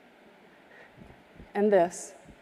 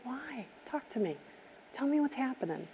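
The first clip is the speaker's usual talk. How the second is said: very soft, near-hushed pleading; soft coaxing; 4 semitones higher, 12 dB quieter than usual. Retold or imitated. Begging the tearful guard to open up, very softly. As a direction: draw her out tenderly, barely above a breath.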